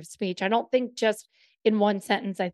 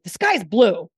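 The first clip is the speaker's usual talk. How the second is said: snapped "The sky is blue", blue louder and falling hard; irritated demo of a simple fact; mad; 7 dB louder than usual. Shown as a demonstration. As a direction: say it like you're annoyed. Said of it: "Did I sound mad?".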